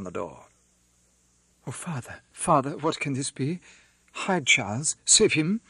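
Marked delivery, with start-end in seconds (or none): 1.6-5.6 rising into a higher, louder cry on save him; a panicked daughter begging for help; mild building alarm; frightened plea. A narrator's reading. Daughter begs father to hide husband.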